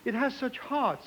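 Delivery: ordinary talk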